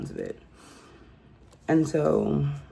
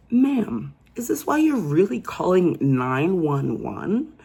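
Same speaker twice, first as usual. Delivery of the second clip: incredulous scolding; 4 semitones higher, 4 dB louder, slower than usual. Pretend. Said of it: "mocking the caller directly".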